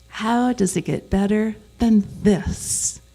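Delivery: "this" set apart and punched at the end; slow build to a strong "this"; stress on this. Shown as a demonstration. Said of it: "where you emphasize each word in a sentence".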